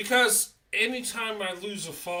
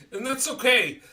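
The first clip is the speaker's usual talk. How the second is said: laughing it off, louder and higher; 7 semitones higher, 9 dB louder than usual. Real, not acted. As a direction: chuckle through it, shrugging it off. Laughs off political jab.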